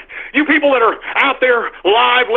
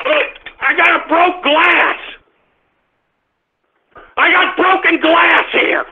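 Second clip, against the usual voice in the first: exasperated; 8 semitones higher, slower than usual. Real, not acted. Upset at broken glass.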